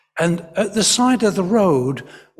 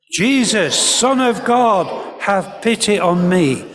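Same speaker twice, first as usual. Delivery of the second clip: raised, drawn-out call; loud as you can; 5 semitones higher, 3 dB louder than usual. Shown as a demonstration. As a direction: lead a crowd in a loud plea. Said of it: "say it as loud as you can".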